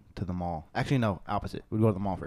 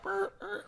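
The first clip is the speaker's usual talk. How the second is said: cart noise imitation; 6 dB quieter than usual. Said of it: "motherfuckers are like".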